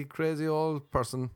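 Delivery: ordinary talk, nothing marked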